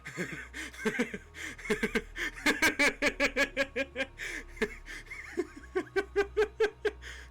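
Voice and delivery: long helpless fit of laughter; can't stop laughing at the dice roll; long real laughter. Real, not acted. Narrator recalling the nat twenty, helpless laughter.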